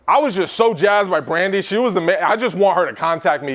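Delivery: loud, gushing fan of the speaker; excited attendee raving after a talk; gushing excited client. Retold or imitated. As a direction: gush like a thrilled client who just heard you.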